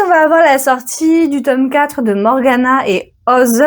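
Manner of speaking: ordinary delivery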